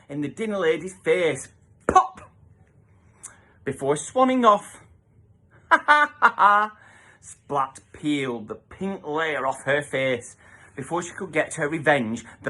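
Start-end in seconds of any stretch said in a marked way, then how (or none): 5.2-6.8 louder taunting laughter; mocking laugh. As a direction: laugh as you swan off. Girl swanning off laughing.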